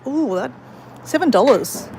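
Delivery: ordinary talk, nothing marked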